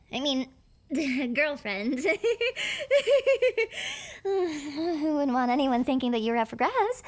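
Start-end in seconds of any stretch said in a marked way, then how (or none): 2.0-4.2 a bright high-pitched giggle. Acted. Girlfriend giggling at her partner, high giggle.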